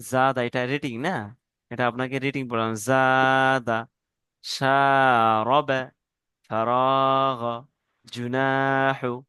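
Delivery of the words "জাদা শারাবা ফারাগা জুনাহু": each word drawn out slowly, like a teacher reading aloud; slow model reading. Shown as a demonstration. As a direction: read every word slowly and clearly for the class. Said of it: "এরকম প্রত্যেকটা প্রথমে reading পড়বেন".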